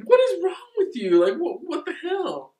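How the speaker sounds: high, loud disbelieving cry with a laugh; half-laughing, half-horrified outburst; shocked disbelief. Retold or imitated. Retelling the girl's reaction, high shocked laugh. Girl finds the doll.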